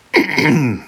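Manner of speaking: throat-clearing cough; small cough. A narrator's reading. Oedipus stalls before his answer, small cough. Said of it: "he coughed".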